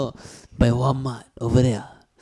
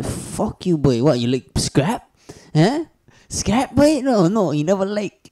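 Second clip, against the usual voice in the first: imitating a taunt, pitch jumping much higher on the challenge; mock tough guy; 5 semitones higher, 3 dB louder, slower than usual. Retelling the guy who pushed him, mock taunt. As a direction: ham up a street bully daring him to fight. Retold bully picking a fight.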